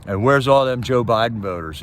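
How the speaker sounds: ordinary delivery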